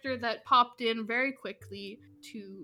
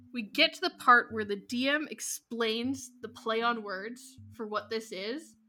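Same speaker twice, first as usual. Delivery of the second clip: usual speaking voice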